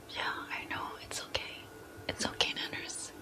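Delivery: hushed, tender comforting whisper; soothing whisper. Acted. Streamer comforting Nanners the plush, whispering softly. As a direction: whisper gently to comfort a sad pet.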